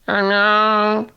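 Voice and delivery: high, drawn-out cat hello; talking hairless cat. Retold or imitated. Owner imitating his hairless cat's odd meow as hello. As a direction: mimic a cat's yowled hello. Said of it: "She goes".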